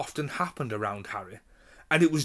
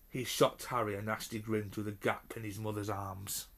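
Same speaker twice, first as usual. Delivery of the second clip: usual speaking voice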